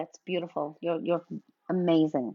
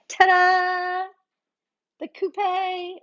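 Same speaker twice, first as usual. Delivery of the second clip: ta-da flourish; 8 semitones higher, 3 dB louder, much slower than usual. Real, not acted. Host presenting the glass.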